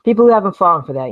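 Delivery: normal talking voice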